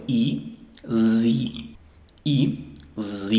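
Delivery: "I" as long "ee", "Z" as "zee"; long E sound. Shown as a demonstration. Teacher building the syllable, long "ee" then "zee". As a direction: alternate "I" as ee and "Z" as zee. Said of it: "la E dice su sonido".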